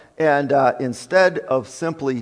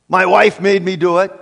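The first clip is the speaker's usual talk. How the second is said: loud, higher-pitched mock excuse; mock blame-shifting; 4 semitones higher, 7 dB louder, faster than usual. Husband shifting blame onto his wife, loud mock protest.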